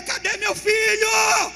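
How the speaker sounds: a father screaming for his son; anguished shout. Acted. Rei desesperado espera notícias. (grito desesperado do rei)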